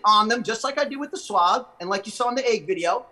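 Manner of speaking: talking around pen tip; pen held at teeth. Real, not acted. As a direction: lips parted over pen. Seen 0: Pen tip held against front teeth while talking, from 0.6 on.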